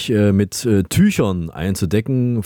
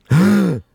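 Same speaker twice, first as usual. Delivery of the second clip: sharp high gasp; shocked gasp; 8 semitones higher, 4 dB louder than usual. Real, not acted. Reaction to surprising answer. (erschrocken nach Luft schnappen)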